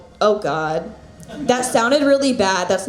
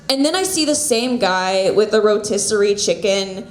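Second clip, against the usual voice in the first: ordinary talk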